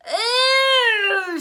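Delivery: shrill loud disgusted cry. A narrator's reading. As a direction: recoil from a stench. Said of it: "Sheila cried".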